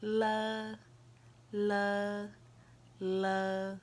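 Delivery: "L" said as a long, level "luh"; letter sound demo. Shown as a demonstration. Teacher modelling the L sound for learners, long level "luh". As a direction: draw "L" out into "luh", held evenly. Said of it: "it ends in the uh sound".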